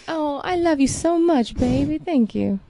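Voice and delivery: high, gushing Southern sweetheart; sweet Southern accent. Acted. Girlfriend thanking her boyfriend for his comment, sweet Southern accent. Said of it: "you have a sweet Southern accent there".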